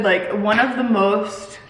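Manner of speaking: ordinary talk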